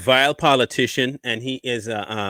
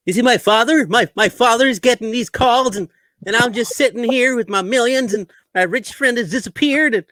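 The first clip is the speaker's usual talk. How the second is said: lightly raised aw-shucks voice; gee-whiz innocent tone; fake wholesome; 8 semitones higher than usual. Hunter Biden parody voice.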